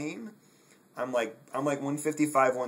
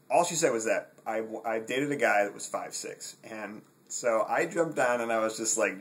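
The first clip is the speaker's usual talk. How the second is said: normal talking voice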